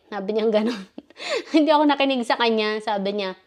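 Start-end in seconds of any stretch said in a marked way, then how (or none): 0.9-1.5 quiet high giggle; soft laugh. Real, not acted. Laughing at what the employer said, soft giggle. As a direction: let out a little soft laugh.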